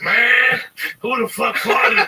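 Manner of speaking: loud, slow, indignant who the fuck farted; loud disgusted demand, slow; annoyed junkie. Retold or imitated. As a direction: bellow it slowly, disgusted. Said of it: "And he's like".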